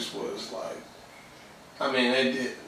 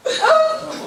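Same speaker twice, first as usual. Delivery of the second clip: much higher, laughing squeal. Real, not acted. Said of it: "I'ma let it go".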